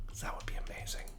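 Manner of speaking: hushed hopeful whisper. Real, not acted. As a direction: breathe it out soft. Hopeful aside at generator.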